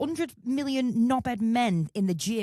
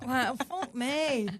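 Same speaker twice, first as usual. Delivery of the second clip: ordinary talk, nothing marked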